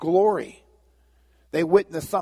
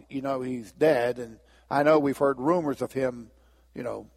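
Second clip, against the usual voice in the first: ordinary talk, nothing marked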